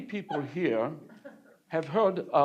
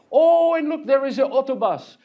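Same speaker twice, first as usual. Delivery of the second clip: higher, louder delighted exclaim; young painter delighted by the horse bus; excited; 9 semitones higher, 6 dB louder than usual. Acted. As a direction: point it out like a thrilled tourist. Excited at the sight.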